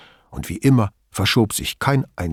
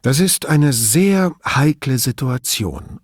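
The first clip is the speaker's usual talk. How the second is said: slow, serious delivery; grave voice; 3 semitones higher, 3 dB louder, slower than usual. A narrator's reading. Commissario sizing up his boss's delicate situation, grave. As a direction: weigh it gravely and take it slowly. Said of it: "mit ernster Stimme".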